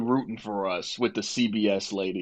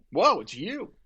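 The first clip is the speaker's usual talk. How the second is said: mildly amazed, higher-pitched retelling; starstruck reaction; 7 semitones higher than usual. Retold or imitated. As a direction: replay the amazed greeting, pitched up.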